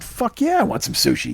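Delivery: high-pitched enthusiastic agreement; re-enacted excited reply; eager yes. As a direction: jump at the offer, voice leaping up. Eager answer to sushi offer.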